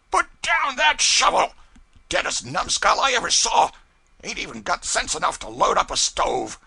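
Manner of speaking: very high, loud furious yell; shout. A narrator's reading. Pilot yelling at the cub at the stove, very high and loud. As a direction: roar at him mid-task. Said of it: "Presently he shouted".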